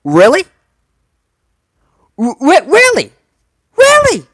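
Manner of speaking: high, loud disbelief; can't believe it, voice cracks up high; incredulous. Real, not acted. Fan incredulous at booking.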